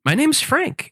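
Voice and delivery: "My name's Frank" way up high; high-pitched answer. Retold or imitated. The Frank answering a stranger, pitched very high. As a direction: squeak it out innocently.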